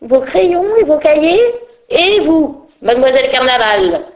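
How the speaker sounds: high, loud scolding teacher voice; teacher scolding the late fairy, high and loud; stern schoolmistress. Acted. Teacher interrogating.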